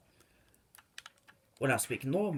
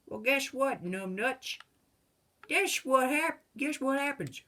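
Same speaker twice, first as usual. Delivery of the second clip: very high, taunting comeback; mocking retort; 13 semitones higher, 3 dB louder than usual. Retorting to the government's idea, high mocking taunt. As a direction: jeer it in a high mock voice.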